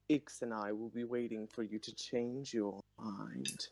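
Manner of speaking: low, even character threat; a low, steady parting line. Acted. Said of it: "Ix's voice gets deeper".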